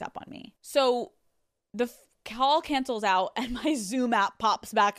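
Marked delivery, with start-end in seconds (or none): none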